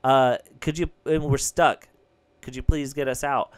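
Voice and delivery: loud, fast, urgent call for help; stuck in an elevator, shouting loudly to the operator; loud pleading shout. Retold or imitated. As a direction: shout it so the faint speaker hears. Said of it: "I was like".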